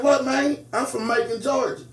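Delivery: lightly imitated Georgia twang, puzzled; imitated country twang. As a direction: mimic a Southern soldier's country accent. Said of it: "you talk like that for real".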